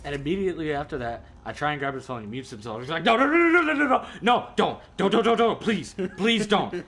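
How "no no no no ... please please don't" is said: high, loud imitation of panicked begging; mock frantic pleading. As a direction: imitate a friend desperately begging you to stop. Acts out friend begging him not to.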